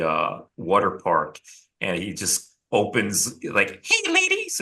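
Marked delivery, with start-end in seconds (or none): 3.8-4.6 squeaky falsetto greeting; super high voice. Retold or imitated. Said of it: "with, like, a super high fucking voice".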